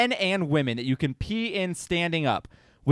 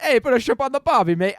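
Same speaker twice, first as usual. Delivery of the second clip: high, hammy Australian accent; a lightly voiced Australian; 6 semitones higher than usual. Shown as a demonstration. Asked by a cohost, a quick Australian accent. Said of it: "Can you do Australian".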